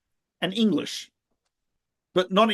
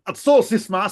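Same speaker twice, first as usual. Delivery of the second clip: higher, louder mock challenge; demonstrated guard's yell; 5 semitones higher, 6 dB louder than usual. Guard challenging someone approaching, mock yell. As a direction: call out the order like a guard. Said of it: "you yell at them".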